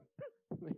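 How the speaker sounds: quick real laugh at his own joke; a brief burst of laughter, high; short laugh. Real, not acted.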